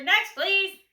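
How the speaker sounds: loud, high call; loud dismissal. A narrator's reading. Grocer loudly calling the next customer.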